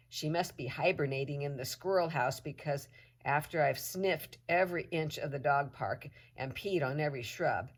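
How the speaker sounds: ordinary delivery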